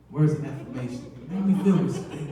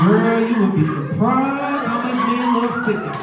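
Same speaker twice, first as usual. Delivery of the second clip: louder and higher, laughing; 7 semitones higher, 7 dB louder than usual. Retold or imitated. Mom telling her son's wife about stickers, laughing loudly. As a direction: laugh as you confide it.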